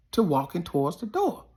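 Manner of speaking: ordinary delivery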